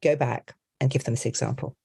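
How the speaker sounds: ordinary talk, nothing marked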